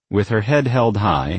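ordinary delivery

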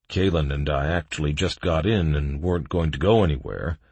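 ordinary delivery

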